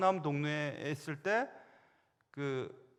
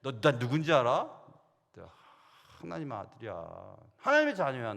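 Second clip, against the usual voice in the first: bold challenge to the demon; 3 semitones lower, 6 dB louder than usual. Pretend. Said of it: "나 그렇게 얘기할 겁니다".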